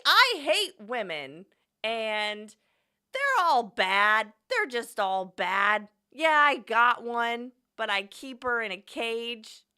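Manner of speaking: loud, slow hammed-up bigot rant; mock bigot voice. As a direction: ham up a hateful caricature. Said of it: "pretend to be John".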